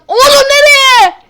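high slow half-laughing cry; screaming while falling. Real, not acted. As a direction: shout in laughing despair.

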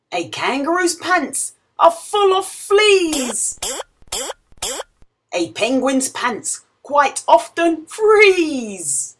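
ordinary delivery

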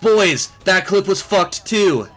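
slow, high hype shout; frat bro. Real, not acted. Gamer hypes his clip.

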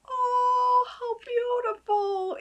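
high delighted gasp of wonder; high, amazed sigh; awed cry. Real, not acted. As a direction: squeal it in admiration. Marveling at bottle.

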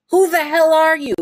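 shrieked high and loud; furious demand. Acted. Captive yells at the masked man, loud shrieking demand. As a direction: yell it at your captor, high and raw. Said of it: "you demand".

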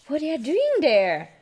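plain reading voice

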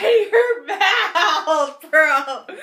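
laughing shout; cracking up while shouting. Real, not acted. Friend laughs at her mouth.

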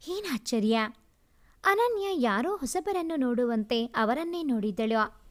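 ordinary delivery

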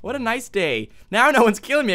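ordinary talk, nothing marked

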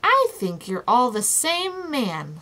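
slow, weighted "all the same man"; emphatic, measured "all the same man". A narrator's reading. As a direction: land "all the same man" firmly and deliberately. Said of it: "said Milo emphatically".

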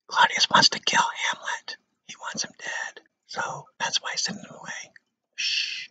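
hushed conspiratorial whisper; mock-secret whisper to the students; stage whisper. Teacher whispers a secret aside.

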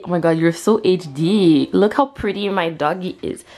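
ordinary talk

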